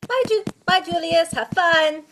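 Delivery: put-on high, loud, bright child's farewell; cheery goodbye. Prue chirps a goodbye to Julius, high and loud. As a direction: wave him off gaily.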